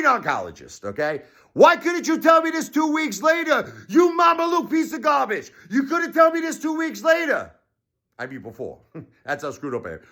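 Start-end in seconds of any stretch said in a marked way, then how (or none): 1.5-7.5 high, strained shout of blame; furious high shout. Real, not acted. Blaming the oncologist for his wife, furious high shout. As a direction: scream it at the doctor.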